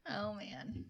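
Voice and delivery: ordinary delivery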